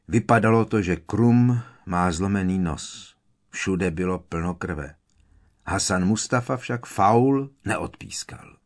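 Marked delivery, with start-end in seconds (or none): none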